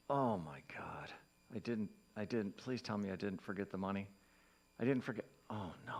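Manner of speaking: hushed, low, dawning dismay; sinking "oh no", low and halting; acted dread. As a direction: plead softly with yourself as it sinks in. Acts out realizing money was forgotten.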